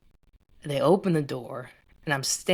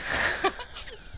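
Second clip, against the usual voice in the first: airy laugh up high; high breathless laugh; 4 dB quieter than usual. Real, not acted. Laughs at the story.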